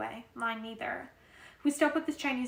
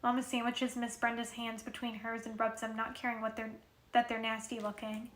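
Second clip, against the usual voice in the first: ordinary delivery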